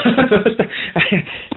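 high burst of laughter; laughing. Real, not acted. Dad stops the child's song start, laughing. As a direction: break up laughing before the song. Child gets nervous before singing.

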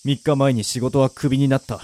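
low, subdued inner narration; luckless man's monologue. Acted. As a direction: narrate your own misery, parched and worn out. Down-on-his-luck hero's monologue. (疲れた男の低い独白)

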